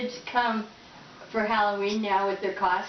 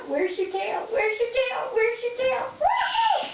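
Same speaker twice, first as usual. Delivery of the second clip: excited high shouting; 14 semitones higher than usual. Real, not acted. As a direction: squeal it at the bird. Teasing bird, high shouts.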